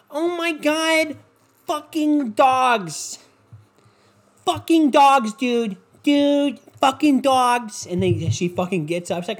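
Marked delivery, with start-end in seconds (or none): 0.1-7.9 high, slow, drawn-out gushing over dogs; high gushing parody of a dog lover; mocking a squealing nurse. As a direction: ham up a dog-obsessed squeal, stretching every word. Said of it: "nurse lady loses her shit".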